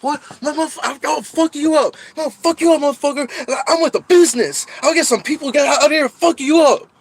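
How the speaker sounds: high, loud mocking yell of a threat; threatening shout. Retelling the neighbor's threat in a loud high yell. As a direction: bark the threat like the angry neighbor.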